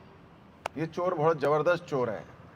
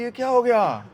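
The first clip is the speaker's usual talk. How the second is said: high, loud bewildered cry; acted shock at the switch; हैरान गोपी; 5 semitones higher, 6 dB louder than usual. हक्की-बक्की गोपी. (हैरानी से ऊँची आवाज़ में)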